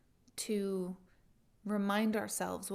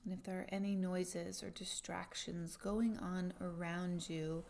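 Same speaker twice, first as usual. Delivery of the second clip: guided meditation; 8 dB quieter than usual. Acted.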